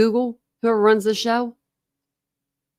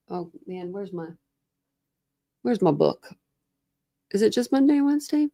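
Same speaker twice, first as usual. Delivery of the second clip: ordinary talk, nothing marked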